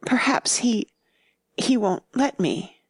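whispery, halting start; hesitant low voice. A narrator's reading. Nurse half afraid of the boy, hesitating in a low voice. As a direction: falter under your breath. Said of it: "She hesitated in a low voice".

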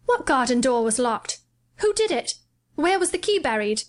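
suddenly hooked, pressing her; exclaiming. A narrator's reading. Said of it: "he exclaimed".

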